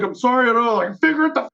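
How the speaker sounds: high-pitched mock rant; retold angry snap at the sound guy; angry retort. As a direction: spit it back with scorn.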